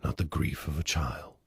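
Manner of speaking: soft whispered close; whispered line. Acted.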